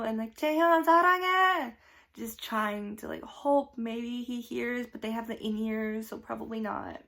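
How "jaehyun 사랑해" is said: loud high fan scream; Korean: 무대를 향해 외치는 사랑 고백; screaming love. Retold or imitated. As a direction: yell it to the stage. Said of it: "screamed at the top of my lungs".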